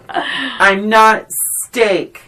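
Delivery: high, drawn-out imitation of a wounded protest; vulnerable woman fighting back. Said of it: "starts feeling herself fall back into that voice".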